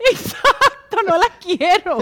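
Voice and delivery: cracking up while refusing; a real laugh, slow and loud. Real, not acted. Laughing at the joke.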